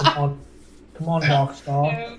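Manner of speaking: put-on slow James Bond style British accent; deep British accent. To friends, a slow suave British accent. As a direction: purr it slow like a British spy. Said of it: "that's like James Bond almost".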